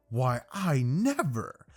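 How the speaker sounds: slow, drawn-out mock offense; hammed-up scandalized gasp of words; affronted. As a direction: act deeply insulted, drawing it out. Ida offended, acted.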